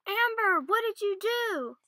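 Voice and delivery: high, loud and slow, horrified; shocked cry. Acted. Elizabeth to computer Amber, horrified loud cry. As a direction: demand it of the computer in disbelief. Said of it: "said Elizabeth".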